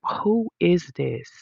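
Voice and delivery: slow, punched mock all-caps question; all caps comment. Commenter's rude all-caps reply, slowly stressed. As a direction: read each word like it's typed in capitals. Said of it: "type it in all caps".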